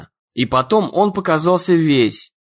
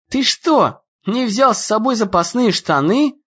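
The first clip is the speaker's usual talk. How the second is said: much higher, astonished question; surprised; 6 semitones higher than usual. A narrator's reading. Dan asks Ian in amazed disbelief, much higher. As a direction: ask in disbelief. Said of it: "удивился Дэн".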